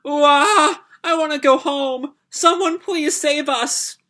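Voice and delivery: put-on high, wailing little girl sobbing; very high bawling child's plea; child crying. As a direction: wail like a frightened young child. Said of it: "little girl there... crying".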